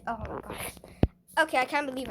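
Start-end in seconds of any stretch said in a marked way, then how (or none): 0.1-0.7 whispered to herself, caught out; embarrassed whisper. Real, not acted. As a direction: hush it like you just embarrassed yourself. Embarrassed aside after pushing viewers.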